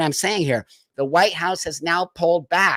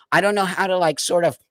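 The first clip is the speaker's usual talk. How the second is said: ordinary delivery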